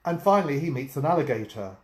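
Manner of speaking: usual speaking voice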